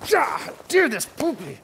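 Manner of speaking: high cooing pet voice. Real, not acted. Cooing at the dog.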